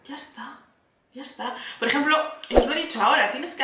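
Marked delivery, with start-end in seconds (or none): 0.1-1.5 soft relief. Retold or imitated. Relief after therapy realization.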